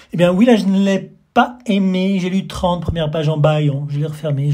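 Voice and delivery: loud, high blustering confession. Acted. Guest loudly admitting he disliked the book. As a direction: blurt it out brazenly.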